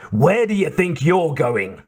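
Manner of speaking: slightly hammed angry driver; mild yell. A narrator's reading. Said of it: "the Mercedes driver yelled".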